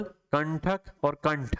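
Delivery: कंठक और कंठ slowly, nasal न clearly ringing; slow nasal demo. Shown as a demonstration. Teacher drilling nasal words for students, slow. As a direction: spell out कंठक और कंठ slowly through the nose. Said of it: "आप ऐसे नासिका को पकड़ेंगे".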